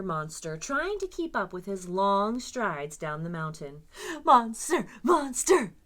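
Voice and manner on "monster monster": louder cheering call; cheered. Acted. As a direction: chase after him cheering. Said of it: "they cheered after him".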